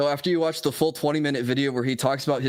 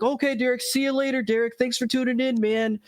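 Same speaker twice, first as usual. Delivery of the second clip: high cheery goodbye voice; bright high streamer sign-off; Phil impression; 10 semitones higher than usual. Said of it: "He's like".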